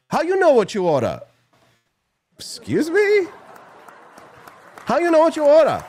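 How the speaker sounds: very high, indignant put-on Chinese accent; mock Chinese accent. Host retelling the cashier's retort, high mock Chinese accent. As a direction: squeal it out in a mock accent, affronted. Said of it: "enough with the racist jokes".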